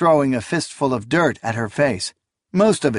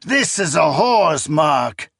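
put-on furious old priest; 5 semitones higher, much slower than usual. A narrator's reading. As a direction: denounce her in fury. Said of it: "he cried".

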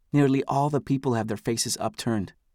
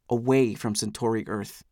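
usual speaking voice

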